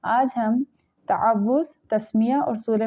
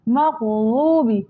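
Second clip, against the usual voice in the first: ordinary delivery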